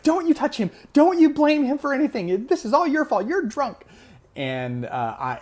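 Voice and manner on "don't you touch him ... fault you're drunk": high, loud imitation of a scolding mother; shrieky mock outrage, pitched way up; angry protective mom. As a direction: mimic mom tearing into her drunk husband. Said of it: "my mom comes running out there".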